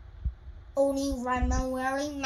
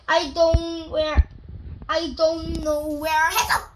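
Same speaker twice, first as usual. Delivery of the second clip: put-on little boy; 2 semitones higher, 7 dB louder than usual. Little Max complains his mask is lost, louder. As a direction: voice Max as a whiny kid.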